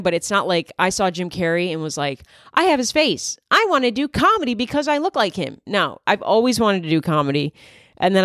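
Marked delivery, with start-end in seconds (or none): 2.5-5.6 high-pitched, hammed-up declaration; mock-excited imagined self, pitched way up; mock eureka. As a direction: announce it like a silly big revelation. Mocking imagined younger self's reasoning.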